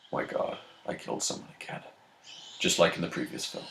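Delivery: hushed, barely audible aside; very soft, acted dismay under the breath; mock horrified whisper. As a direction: whisper it as if appalled at yourself. Acts out Superman's horrified aside.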